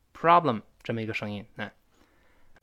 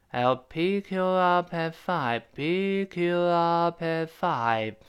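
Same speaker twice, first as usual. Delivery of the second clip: 'pick up at five' very slowly, syllables stretched and joined; slow-motion demonstration; 3 semitones higher, much slower than usual. Shown as a demonstration. Teacher breaking down the link, slow-motion drawn-out reading. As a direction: sustain every syllable of 'pick up at five' evenly.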